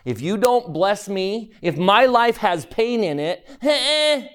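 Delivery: high, whining complaint ending in a huff; whining at God. Retold or imitated. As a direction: sulk at God like a spoiled child. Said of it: "and we whine".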